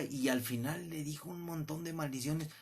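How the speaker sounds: usual speaking voice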